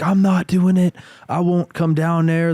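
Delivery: hammed-up girlfriend refusing to move; mock freaking out. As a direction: play her refusing in a panic.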